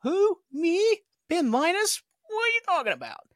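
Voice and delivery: high incredulous who-me impression; mock innocent Ben. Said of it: "And he's like".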